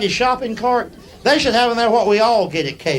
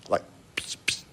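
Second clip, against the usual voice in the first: mock spray-can psst; spray hiss; 13 dB quieter than usual. Miming butter spray mist.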